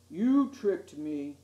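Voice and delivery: the betrayed fish lets it out; hurt cry from the fish. A narrator's reading. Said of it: "he cried".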